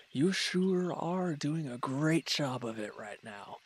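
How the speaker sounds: dripping sarcasm about the bandaging; sarcastic jab. Acted. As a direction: drag it out to needle the clumsy helper. Injured, annoyed at clumsy caretaker.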